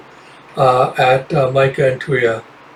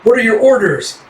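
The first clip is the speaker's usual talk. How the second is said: called out; 7 semitones higher than usual. Acted. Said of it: "Jeff screams to the forest".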